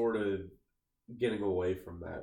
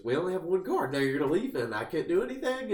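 mock berating, raised in pitch; 7 semitones higher, 4 dB louder, faster than usual. Husband complaining to his wife about the car, retold as berating. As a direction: imitate a husband scolding, voice climbing. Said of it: "like, berating her".

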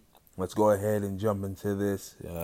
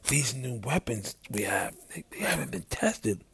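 soft, higher, hushed confiding; whisper; 4 semitones higher, 7 dB quieter than usual. A narrator's reading. Knox warning Arthur about untested weapons, whisper. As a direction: lean in and keep it hushed. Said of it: "he leans in to whisper".

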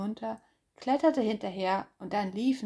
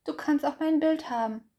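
a bit higher, offering; lightly voiced hopeful hare; 7 semitones higher, 4 dB louder than usual. Hase offers Bär his picture, hopeful. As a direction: offer it eagerly.

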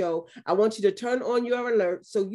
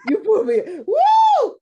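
loud high joyful whoop; excited whoop; 11 semitones higher, 10 dB louder than usual. Real, not acted. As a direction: let out a big happy woo. Excited shout after laughter.

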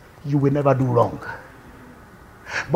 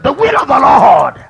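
firm declaration; 5 semitones higher, 10 dB louder, slower than usual. Retold or imitated. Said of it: "I said to myself".

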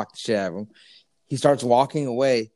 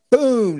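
loud, much higher shouted boom; imitated slap boom; 12 semitones higher, 8 dB louder than usual. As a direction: punch out the impact. Retelling the big slap.

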